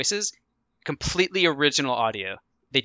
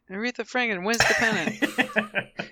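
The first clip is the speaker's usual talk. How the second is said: newsreel announcer; 6 semitones higher, 3 dB quieter than usual. Pretend. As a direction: announce it like a headline. Newspaper headline announcer voice.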